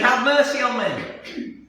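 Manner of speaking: high, slow imitated begging; persistent plea. Woman follows them around appealing, imitated slow pleading. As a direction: draw out a mock persistent appeal. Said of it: "just following them around and saying".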